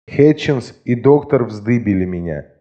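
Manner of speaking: plain reading voice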